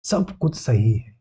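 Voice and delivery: very slow, drawn-out affirmation; calm self-affirmation. Shown as a demonstration. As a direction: say it slowly, as a morning mantra. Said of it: "हमेशा सुबह उठ के यही बोलें".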